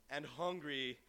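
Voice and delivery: ordinary delivery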